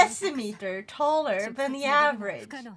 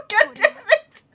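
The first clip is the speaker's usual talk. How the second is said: laughing through the curse; 13 semitones higher, 9 dB louder than usual. Real, not acted. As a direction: swear while laughing hard. Laughing at the joke.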